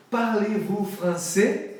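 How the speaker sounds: slow French question. Shown as a demonstration. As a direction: ask it like a Frenchman. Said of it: "Se vocês falam French". (pergunta em francês, devagar)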